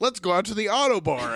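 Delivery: high-pitched imitation of keen friends; put-on group enthusiasm; mock eager suggestion. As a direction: pitch it up, cheerily oblivious. Friends eagerly proposing a night out.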